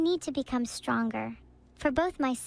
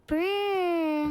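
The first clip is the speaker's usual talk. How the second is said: slow rolling lip trill like rotor blades; helicopter noise; 4 semitones higher than usual. Shown as a demonstration. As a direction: buzz your lips like a chopper. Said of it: "I have to make helicopter noises".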